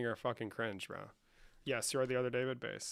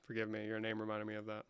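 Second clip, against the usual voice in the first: ordinary talk, nothing marked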